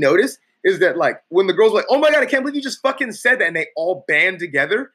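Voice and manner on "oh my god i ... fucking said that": mock outraged girl. Girl berating him, mocked.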